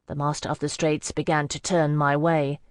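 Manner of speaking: ordinary delivery, nothing marked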